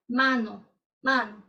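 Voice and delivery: "mano" with a wide open Spanish a. Shown as a demonstration. Teacher showing students the open a in "mano". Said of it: "ele é mais aberto".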